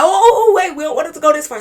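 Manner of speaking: very high, loud, fast panic; panicked. Retold or imitated. Friend panicking when the officer threatens jail, high loud fast. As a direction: blurt it out in a rush of alarm.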